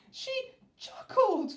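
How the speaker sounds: high, loud, slow distressed king; the king protests to the soldier, crying out. Acted. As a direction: cry it out in dismay. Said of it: "cried the king".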